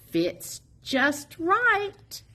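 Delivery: ordinary delivery, nothing marked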